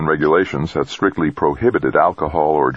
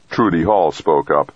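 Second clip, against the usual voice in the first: usual speaking voice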